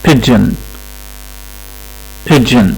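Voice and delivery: pigeon with soft G, PIDGE-in, short i; model pronunciation. Shown as a demonstration. Teacher repeats the word for the class, careful pronunciation. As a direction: say pigeon the right way, clearly. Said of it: "ال P اتنطقت زي ما هي".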